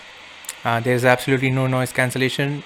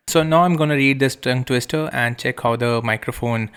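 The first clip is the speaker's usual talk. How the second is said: normal talking voice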